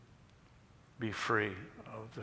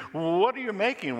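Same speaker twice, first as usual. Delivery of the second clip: high, raised storyteller's shout; louder shout; 11 semitones higher, 8 dB louder than usual. A narrator's reading. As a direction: demand an answer from the stranger. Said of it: "One shouted even louder".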